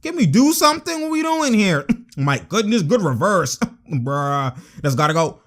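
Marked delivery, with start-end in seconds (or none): 0.0-1.9 high, pleading exasperation; exasperated. Real, not acted. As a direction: beg the team to do something. Pleading with struggling team.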